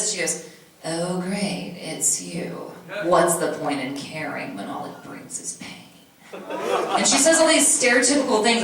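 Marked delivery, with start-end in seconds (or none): 0.9-6.4 put-on low, soft, world-weary emo teen; gloomy low emo drone, trailing slower; emo scene kid. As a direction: drag it out quietly like a jaded emo kid. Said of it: "she talks like this".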